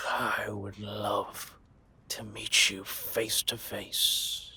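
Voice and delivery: very slow, drawn-out longing; slow, predatory relish; savoring menace. Acted. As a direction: draw each word out, relishing the thought. Predator longing to meet target.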